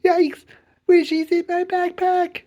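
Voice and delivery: put-on loud, high-pitched child's yelp; alarmed cry. A narrator's reading. Charlie realizing his pet is missing, crying out. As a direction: cry out in sudden panic. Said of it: "cried Charlie".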